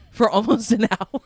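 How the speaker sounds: barely holding in the laugh. Real, not acted. Retelling punchline while cracking up.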